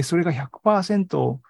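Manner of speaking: ordinary talk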